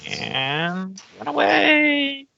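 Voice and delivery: high, stretched-out sing-out; teasing getaway. Acted. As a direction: draw it out, sliding way up in pitch. Game master narrates monster fleeing.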